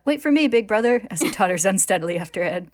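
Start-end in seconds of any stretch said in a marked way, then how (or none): 0.1-1.1 high, loud fake kid voice; man badly faking a young boy, calling out; put-on boy voice. A narrator's reading. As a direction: call after big brother in a pitched-up boy voice. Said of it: "puts on his best fourteen-year-old boy voice and calls out".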